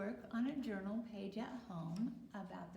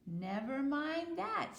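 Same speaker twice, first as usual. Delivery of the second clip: slow, high duck voice; 6 semitones higher, 5 dB louder, slower than usual. Acted. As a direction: brush it off like a duckling. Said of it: "said the duck".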